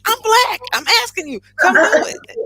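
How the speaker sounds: high, loud comeback acted out; indignant retort. Business owner retells pleading with stylists, indignant loud retort. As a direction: push back loudly, pitch jumping up.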